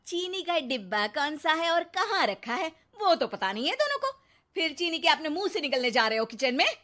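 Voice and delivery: sarcastic housewife. Acted. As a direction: needle the two show-offs. Neha mocks husband and son. (ताना मारती ऊँची आवाज़)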